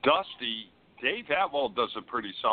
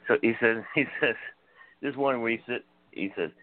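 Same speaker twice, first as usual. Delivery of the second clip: ordinary delivery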